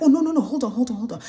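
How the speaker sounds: pushy insistence. Retold or imitated. Driver blocks her leaving.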